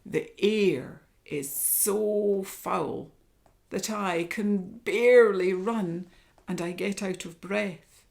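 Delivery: slow, loud tiger complaint; tiger growling. Acted. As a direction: drag the words out as a fed-up tiger. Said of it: "growled Tiger".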